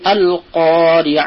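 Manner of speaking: আল কারিয়া with a firm hamza on আল; slow recitation. Shown as a demonstration. Teacher recites the sura's opening word. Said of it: "তখন tight আদা হবে".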